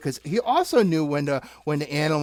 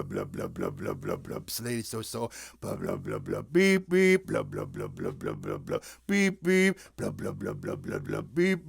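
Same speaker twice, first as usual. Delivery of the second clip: traffic jam noises; 7 semitones lower, 5 dB quieter than usual. Pretend. Acting out a traffic jam for the kids with blahs and beeps. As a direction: rumble out car noise and honk beep beep.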